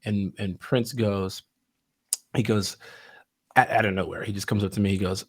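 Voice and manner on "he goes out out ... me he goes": usual speaking voice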